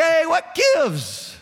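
high, drawn-out mock protest; indignant bewilderment. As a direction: play a baffled new believer complaining. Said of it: "everybody that used to love you now hates you".

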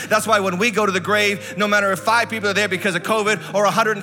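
urgent, unbroken pulpit cadence; full-force preaching. Real, not acted. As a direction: build into a triumphant proclamation.

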